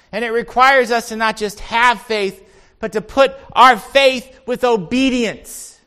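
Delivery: loud, high, driving sermon voice; impassioned preaching. Real, not acted. Preacher telling congregation what Jesus does in us, impassioned. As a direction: preach it out with conviction.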